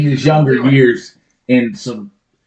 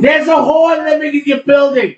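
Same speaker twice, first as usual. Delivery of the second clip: high shouting; 13 semitones higher than usual. Retold or imitated. Yelled sketch quote.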